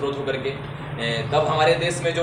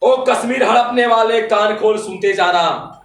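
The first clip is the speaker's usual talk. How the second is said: high, louder declamation of patriotic verse; fiery poetry recitation; 9 semitones higher, 7 dB louder than usual. Acted. Poet rousing the audience to wake India, fiery declamation. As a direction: declaim it as a rousing call to arms.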